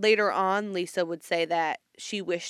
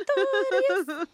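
mock rapper hype; 3 dB louder, much slower than usual. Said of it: "naming the rapper after the quote".

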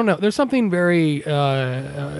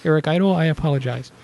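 ordinary talk

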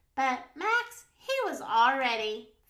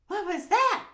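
quick, high, loud cry; startled yelp; 9 semitones higher, 7 dB louder than usual. A narrator's reading. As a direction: yelp it in sudden fright. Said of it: "yelped Max".

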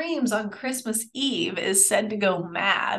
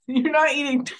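ordinary talk

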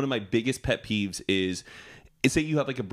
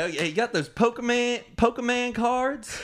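clueless dad; 14 semitones higher than usual. Acted. Mimicking a dad asking about cards, exaggerated high voice. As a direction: play a dad fumbling the word, pitched high. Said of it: "that's like a dad calling".

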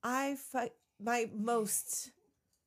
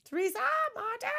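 high, quick mock summons; calling out; faster than usual. Auntie Shrew arriving looking for the family, calling out. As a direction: call the names out in a high voice.

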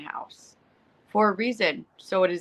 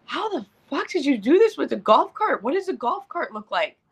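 mock outraged disbelief; 9 semitones higher than usual. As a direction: blurt it out at the wreck in disbelief. Mom seeing the wrecked truck.

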